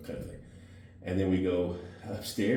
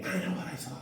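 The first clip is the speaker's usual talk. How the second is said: contained, high imitation of a screamed retort; retold scream; 11 semitones higher than usual. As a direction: imitate her screaming it, voice pitched way up. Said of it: "she screamed at me".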